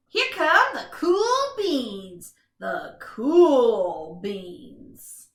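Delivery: drawn-out, very slow cool-guy voice; slow cool announcer. A narrator's reading. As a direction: stretch it out like they're strolling in. Announcing the cool beans' entrance.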